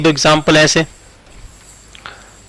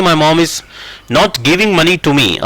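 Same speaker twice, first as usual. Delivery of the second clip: very slow, spaced words; slow dictation; much slower than usual. Shown as a demonstration. Teacher dictating a second version to students, very slow. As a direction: read the alternative slowly. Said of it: "आप इसको ऐसे भी बना सकते हैं".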